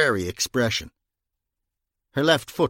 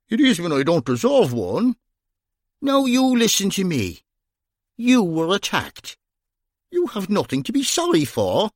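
higher, emphatic reassurance; insistent Irishman; 8 semitones higher than usual. Acted. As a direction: firmly tell her it's not her fault. Bunny insisting she is blameless.

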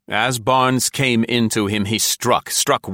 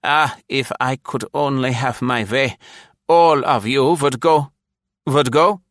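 villain voice; 6 semitones higher, 4 dB louder than usual. A narrator's reading. Rothstern voiced, fading to stroke.

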